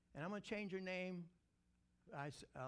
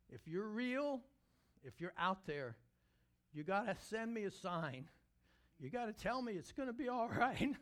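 raised, pleading retold prayer; desperate plea; 5 semitones higher, 4 dB louder than usual. Woman begging God for a sign, imitated desperate plea. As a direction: plead upward, rising higher at the end.

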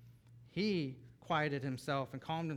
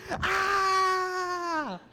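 long, loud, high-pitched joyful scream; imitated child's squeal; 10 dB louder than usual. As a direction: scream like a thrilled toddler, held long. Child squeals seeing daddy.

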